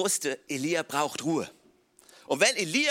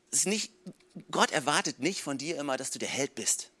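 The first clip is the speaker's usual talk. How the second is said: usual speaking voice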